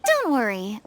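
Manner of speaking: ordinary talk, nothing marked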